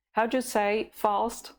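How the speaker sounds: normal talking voice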